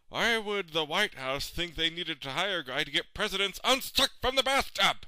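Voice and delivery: exasperated rant. Acted. Stuck president berating himself, increasingly exasperated. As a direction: start irritated, climb to an incredulous yell.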